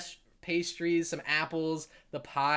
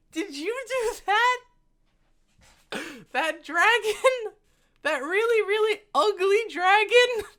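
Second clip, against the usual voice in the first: put-on very high, slow, awestruck child; high childish voice full of wonder; little girl voice; 5 dB louder, slower than usual. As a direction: play a wide-eyed six-year-old girl.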